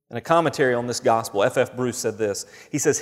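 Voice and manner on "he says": ordinary talk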